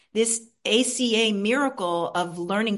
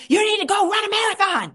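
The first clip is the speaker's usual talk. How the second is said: higher, sharp scolding self-talk; harsh inner critic; 10 semitones higher, 5 dB louder, faster than usual. Retold or imitated. Old harsh voice scolding herself after eating, sharp. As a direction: bark it like a punishing inner critic. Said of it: "instead of saying".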